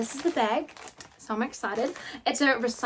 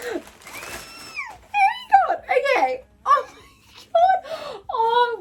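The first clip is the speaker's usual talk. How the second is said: overwhelmed squeal; 3 dB louder, much slower than usual. Real, not acted. Unboxing gift, overwhelmed with emotion.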